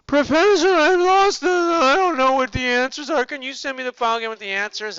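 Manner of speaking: high, loud, hammed-up student panic; mock panicked student. Student begging the professor for the lost file, high mock panic. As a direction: squeal it like a frantic student, speeding up at the end. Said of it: "I'll get a panic message".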